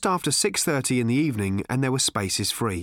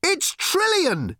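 amazed shout; much slower than usual. A narrator's reading. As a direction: cry out in disbelief. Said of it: "shouted Arthur".

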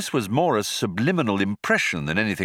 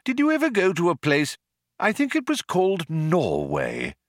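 put-on higher, lilting elderly craftsman; old planet-builder, fond; 8 semitones higher than usual. A narrator's reading. Old man asking visitor about Norway, fond. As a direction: reminisce like a pleased old man.

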